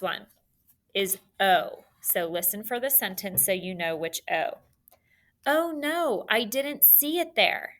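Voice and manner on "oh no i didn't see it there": high-pitched mock "oh no" exclamation; acted dismay. Teacher acts out the example sentence, mock dismay. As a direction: act out a dismayed surprise, pitched well up.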